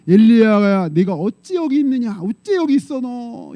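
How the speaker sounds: slow, loud calling voice of God; God calling Elijah. Acted. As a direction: call out slowly to Elijah. (엘리야를 부르시는 하나님, 느리고 크게)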